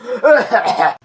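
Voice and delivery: hammed-up coughing fit; put-on sickly hacking; fake cough. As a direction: cough hard to convince mom. Feigning illness to skip school.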